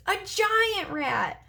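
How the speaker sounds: high and slow, close to crying; near tears. Acted. Marty telling the driver about the rat, near tears. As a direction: drag it out as if about to cry. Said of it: "He was almost in tears".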